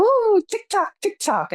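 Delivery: put-on high, wavering old man ooh, TikTok; imitating grandpa. Granddaughter imitating grandpa's greeting, high mock old man voice. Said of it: "he'd go".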